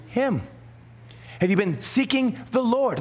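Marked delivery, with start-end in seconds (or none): none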